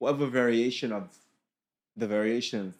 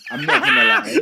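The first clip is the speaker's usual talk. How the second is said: laughing shout; 16 dB louder than usual. Real, not acted. Laughing outburst mid-rant.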